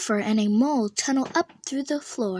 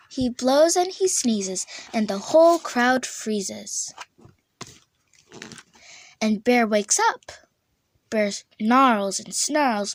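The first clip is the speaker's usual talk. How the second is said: usual speaking voice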